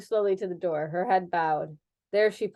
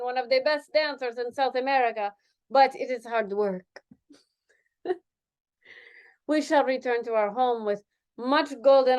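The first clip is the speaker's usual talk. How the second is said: put-on Spanish accent; 2 semitones higher than usual. Dancer voiced with accent.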